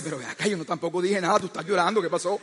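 soft, fast, quiet placating; calm her down, bewildered; placating husband. Retold or imitated. Husband soothes crying wife, soft and hurried.

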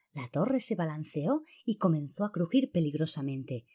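plain reading voice

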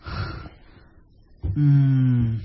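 ordinary talk

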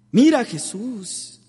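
high, loud gentle appeal; Mary's appeal. Retold or imitated. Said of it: "le dijo María Santísima".

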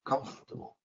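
COMFORTABLE whispered as CUMF-tuh-bul; stressed first syllable. Shown as a demonstration. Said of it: "because of the stress".

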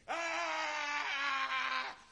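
high strained cry; madman cry. Acted. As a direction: cry out like the possessed man. Demoniac runs and cries out. (grito agudo del endemoniado)

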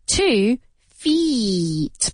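"feet" in "two feet" with a very long ee. Shown as a demonstration. Teacher contrasting one foot and two, "feet" stretched long. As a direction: draw out the vowel of "feet". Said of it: "una I larga".

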